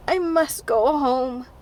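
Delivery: slow, dull but insistent; stupidly determined. A narrator's reading. Tipsy Diana insisting to Anne she must leave, slow and dull. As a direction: plod the words out, dull and stubborn. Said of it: "stupidly but determinedly".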